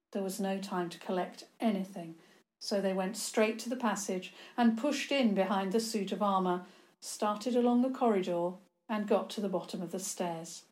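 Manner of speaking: ordinary delivery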